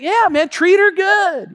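high, loud, drawn-out send-off; hammed-up buddy enthusiasm; acted cheery valet. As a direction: call out a friendly goodbye.

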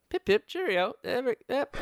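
put-on a jokey British toff accent, pip pip cheerio; mock British accent. Host slips into a mock British accent for his co-host. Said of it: "the middle of the earth accent".

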